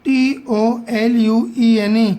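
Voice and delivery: ordinary delivery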